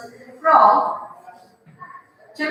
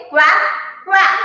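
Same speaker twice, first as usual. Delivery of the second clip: higher quack, louder; repeating the duck sound for the class; 4 semitones higher, 3 dB louder than usual. Shown as a demonstration. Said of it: "What does he say?".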